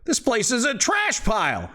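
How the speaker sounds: high, spitting scorn; angry father. Acted. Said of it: "grumbles and spits and says".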